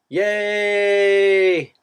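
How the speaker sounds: high, loud cheer; excited cheer. Real, not acted. Celebrating bonus announcement.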